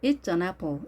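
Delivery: "It's an apple" with It is shortened to It's; contracted form. Shown as a demonstration. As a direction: say "It's an" as one short linked "itsan". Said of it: "주어와 동사를 축약해서 표현하기도 합니다". (It's an apple을 축약해서 짧게)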